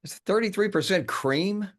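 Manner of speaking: can't believe what he smells; outraged baffled question; incredulous. Acted. Smells the syringe, bewildered.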